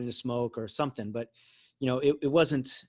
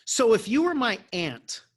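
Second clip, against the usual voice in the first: put-on smart-aleck teen; 9 semitones higher, 4 dB louder than usual. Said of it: "I go".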